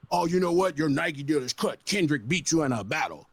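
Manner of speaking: a mocking record exec delivering bad news; mock label exec. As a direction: talk down like a smug suit cutting a deal. Mock label voice cutting deal.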